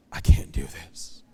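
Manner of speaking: unvoiced, ashamed whisper; a hushed confession; whispered plea. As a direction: barely breathe the admission. Humbling phone call for help.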